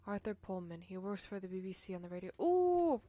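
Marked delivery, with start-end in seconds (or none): 2.4-3.0 high, loud surprised ooh; a sudden delighted gasp of realisation; bright loud ooh. Acted. Surprised at the connection.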